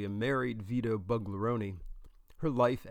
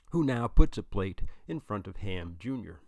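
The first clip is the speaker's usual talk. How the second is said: usual speaking voice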